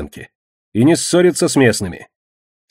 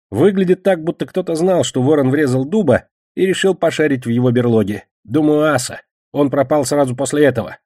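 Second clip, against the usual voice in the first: raised-pitch character voice, reporting; defensive Кегля; 5 semitones higher than usual. Acted. Кегля reports what the landlord said, defensive. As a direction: give an account in a higher voice, easing down.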